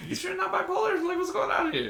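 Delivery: very high-pitched mock bewilderment; bewildered questioning. Puzzled son questioning his dad's sudden calm, bewildered. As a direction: squeak out the question in disbelief.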